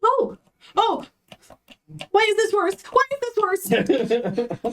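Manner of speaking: high panicked cries. Acted. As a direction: squeal in rising alarm. Player panics at aging things.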